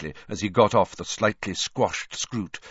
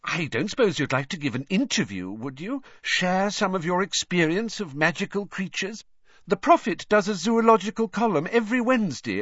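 Rita's voice; 8 semitones higher than usual. A narrator's reading. Rita pitching the interview.

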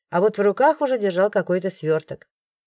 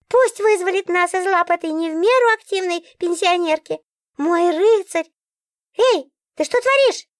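very high, squeaky fussy book; a fussy magic book, fired up; talking book voice; 9 semitones higher than usual. A narrator's reading. (очень высокий голос говорящей книги)

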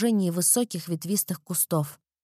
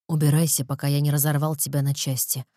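cold, low, menacing threat; dark lord; 7 semitones lower than usual. A narrator's reading. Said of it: "холодно и зловеще произнёс Эрик".

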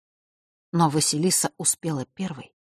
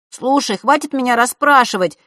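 loud, high angry yell; explosive outburst; 8 semitones higher, 8 dB louder than usual. A narrator's reading. Брат взрывается на сестру, кричит в ярости. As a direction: blow up at her all at once. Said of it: "вдруг взорвался Эрик".

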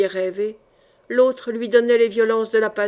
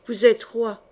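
ordinary delivery, nothing marked

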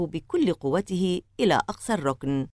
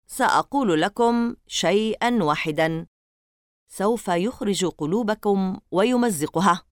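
plain reading voice

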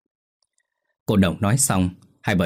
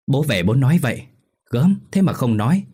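excited; 6 semitones higher, 4 dB louder than usual. Acted. Husband thrilled, hugging his wife.

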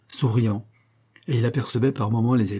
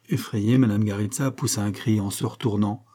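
ordinary delivery, nothing marked

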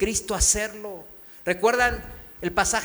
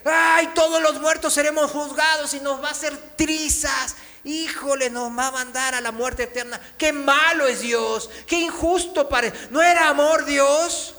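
higher, louder whiny complaint against God; mock complaint; 6 semitones higher, 5 dB louder than usual. Preacher imitating people who call God unjust, mock whining. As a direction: ham up a frightened believer's protest. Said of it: "dicen muchos".

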